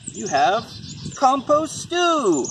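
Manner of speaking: slow, high, drawn-out reveal of compost stew; grand reveal. Acted. Mom revealing the answer to her kids, drawn out and high. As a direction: stretch it out like a big surprise. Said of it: "What do you think you have".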